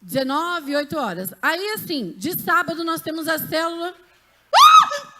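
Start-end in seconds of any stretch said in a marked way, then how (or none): 4.5-5.1 sudden high, loud shriek; startled scream. Real, not acted. Congregation member scares her mid-announcement, startled shriek. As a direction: jump with a fright and cry out. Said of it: "Ai, que susto!".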